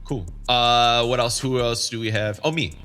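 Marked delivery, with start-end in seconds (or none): none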